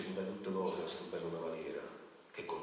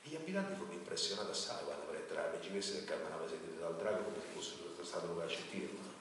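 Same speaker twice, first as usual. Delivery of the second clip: ordinary delivery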